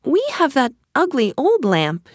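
high, lilting princess voice; light, high-pitched thinking to herself; princess musing. A narrator's reading. Princess hears the peddler's offer, musing. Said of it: "she thought".

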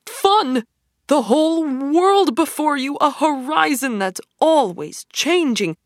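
boastful Toad gushing to his friends; rapturous Toad. Acted. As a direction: sell the open road like a dream. Toad rhapsodizing over his wagon.

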